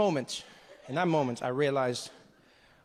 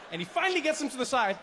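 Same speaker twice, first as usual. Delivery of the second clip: normal talking voice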